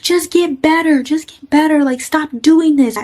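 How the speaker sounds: high pleading retelling of crying at him; retold tearful plea. Narrator crying as she begs her drunk friend, high tearful plea. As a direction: beg him, pitched high, a touch of tears. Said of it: "and I'm crying".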